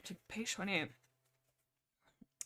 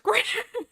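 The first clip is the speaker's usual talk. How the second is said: a squealing laugh, louder; high burst of laughter; 7 dB louder than usual. Real, not acted. Streamer cracking up at the rude book, squealing laugh.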